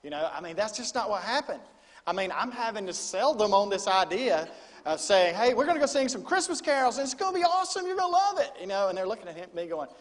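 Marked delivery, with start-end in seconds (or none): 5.3-8.5 much higher and louder, overselling the fun; hyped sales pitch. Retold or imitated. Youth pastor to teenagers, higher and louder hype. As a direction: pitch it like the best idea ever to reluctant teens. Selling carols to teens.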